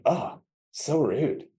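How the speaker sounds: pouty put-on character voice; unmotivated Sammy. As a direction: play a sulky character dragging out "so rude". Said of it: "maybe it's unmotivated Sammy that's like".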